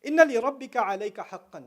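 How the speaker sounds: recited Arabic. Shown as a demonstration. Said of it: "And he says".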